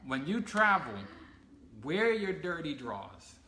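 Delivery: put-on lecturing the kids. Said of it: "Like I told my kids".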